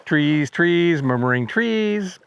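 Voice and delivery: drawn-out, held notes like a warbler's song; slow songlike bird mnemonic; slow birdsong version. Shown as a demonstration. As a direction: chant it slowly, stretching each "trees". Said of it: "it does have a slow version".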